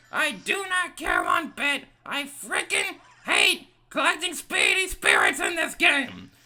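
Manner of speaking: loud, high, raging screams; furious high shout. Real, not acted. Gamer raging loudly at annoying ghosts. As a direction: let it all out in a tantrum. Rage at game.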